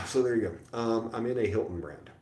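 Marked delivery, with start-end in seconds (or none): 0.1-0.8 loud exasperated sigh. Real, not acted. Sighing at boredom.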